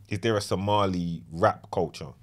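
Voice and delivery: ordinary talk, nothing marked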